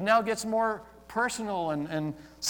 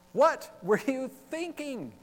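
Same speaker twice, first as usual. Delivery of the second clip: exasperated parent; 7 semitones higher than usual. Retold or imitated. Voicing parent of teenagers.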